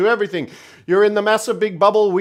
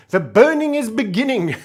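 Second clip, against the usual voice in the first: laughing; 2 semitones higher than usual. Real, not acted.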